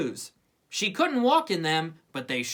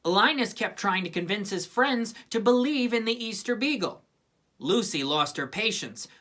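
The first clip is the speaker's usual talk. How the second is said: usual speaking voice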